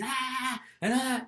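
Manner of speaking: high, half-mocking angry sputter; imitating boss ranting. Young salesman mimics his yelling boss, mock angry sputter. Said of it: "he's going crazy".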